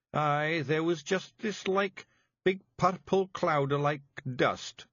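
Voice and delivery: high, thick Scots pixie voice; small tough Scots fairy breaking bad news; Scottish Feegle voice. A narrator's reading. Feegle Daft Woolly.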